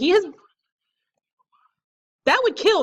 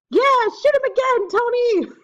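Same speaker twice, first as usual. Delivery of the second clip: high-pitched urging yell; excited yelling; 12 semitones higher than usual. Real, not acted. Player cheering on Tony to shoot, yelling. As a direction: yell it way up high to egg him on. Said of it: "I'm seriously yelling at Tomazuski".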